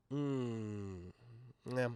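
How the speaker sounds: usual speaking voice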